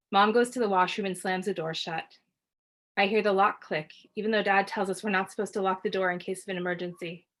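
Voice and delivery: usual speaking voice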